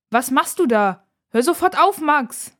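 loud, high-pitched sisterly reprimand; called out sharply. A narrator's reading. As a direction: call out to stop him at once. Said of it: "ruft Nora".

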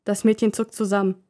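ordinary delivery, nothing marked

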